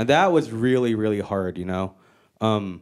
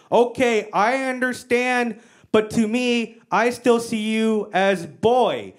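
slow, higher-pitched broken English; imitated mom; 6 semitones higher, much slower than usual. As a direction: concede haltingly as a mock mother. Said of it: "and she goes".

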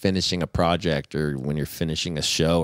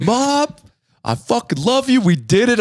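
high, louder shout to mom; mock emotional shout; 4 dB louder than usual. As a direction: yell it out, hammed-up triumph. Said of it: "I'm like".

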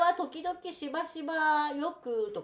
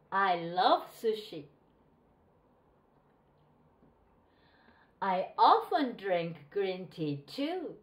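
slow, clear textbook reading with pauses for repeating; slow model reading; 3 dB louder, much slower than usual. Shown as a demonstration. Teacher reading for students to repeat after her, slow and clear. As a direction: read each sentence slowly so the class can repeat. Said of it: "言ってみてください".

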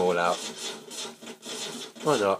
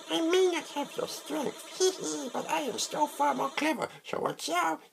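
tiny cocky tinkerer, squeaky and giggling; squeaky little creature; 5 dB quieter than usual. Acted. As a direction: giggle and squeak like a tiny mischievous gadget-maker.

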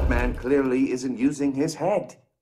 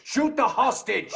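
gleeful laughing order; 12 semitones higher than usual. Acted. Villain gleefully orders shooting.

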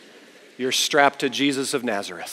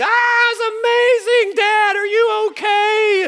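very high, loud squeal of delight; drawn-out piercing mock shriek; imitated excited scream; 7 dB louder than usual. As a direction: scream like a thrilled daughter. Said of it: "She's screaming".

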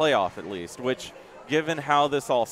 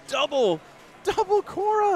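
much higher, louder rising yell of the shot; excited shout; 5 dB louder than usual. Real, not acted. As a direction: yell it as the ball scores twice. Commentator reacts to a big shot.

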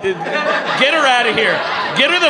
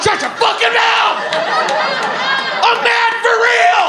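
mock furious. Shouting down a heckler, faking real anger. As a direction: blow up at them, all pretend. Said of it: "I'm not".

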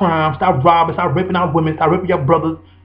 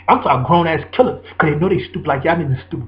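normal talking voice

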